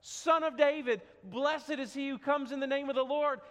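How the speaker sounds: loud, high hosanna cry; preacher imitating the crowd's loud joyful hosanna; crowd shouting. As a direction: shout it like the palm-waving crowd. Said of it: "they're screaming".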